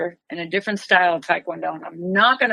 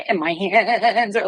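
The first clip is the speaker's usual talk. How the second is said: trembling. Retold or imitated. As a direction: voice wobbling to mimic shaking hands. Acts out hands shaking after confrontation.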